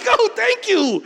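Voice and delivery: very high, loud, giddy relief; mock-overjoyed gratitude; gushing thanks. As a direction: gush it with a laugh. Relieved new citizen, laughing.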